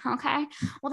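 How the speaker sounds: usual speaking voice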